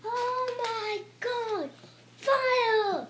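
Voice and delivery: high, slow then loud cry of fire; excited shout. Real, not acted. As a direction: yell it out in awe. Laser about to fire.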